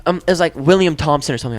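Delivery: ordinary talk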